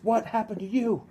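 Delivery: high, loud voiced shock; alarmed question. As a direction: play the startled clerk asking. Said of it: "He goes".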